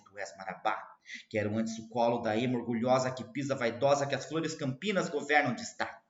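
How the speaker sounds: declaiming the verses aloud; poem recital. Acted. Teacher reciting Marabá to the class, declaimed.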